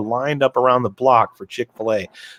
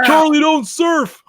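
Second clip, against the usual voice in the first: mock movie line; 14 semitones higher, 4 dB louder, slower than usual. Quoting Apocalypse Now line.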